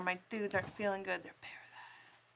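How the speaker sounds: whispering. Real, not acted. Whispered aside.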